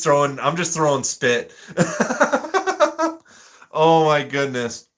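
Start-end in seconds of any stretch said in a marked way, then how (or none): 1.8-3.1 high giggle; real laughter. Real, not acted. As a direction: giggle at your own nonsense. Amused at own nonsense.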